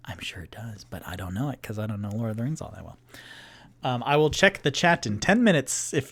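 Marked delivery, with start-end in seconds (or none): 0.1-3.0 soft, fast whispered aside; muttered aside. Real, not acted. Streamer admitting ignorance in a quick soft aside. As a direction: mumble it quickly under the breath. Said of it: "muttered aside".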